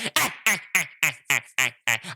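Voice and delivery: a cartoon-villain cackle, rising; evil villain cackle. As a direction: cackle like a scheming mad scientist. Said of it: "I'm on some Doctor Doofenshmirtz shit".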